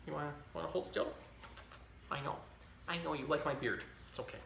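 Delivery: quiet, murmured aside. Real, not acted. Talking gently to the baby on his lap, soft. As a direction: hush it to the little one.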